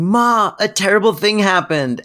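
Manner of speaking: high-pitched, dramatic alarm; bursting in. Retold or imitated. Fran bursting in with bad news for Ma, mock-frantic. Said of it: "Fran bursting in and going".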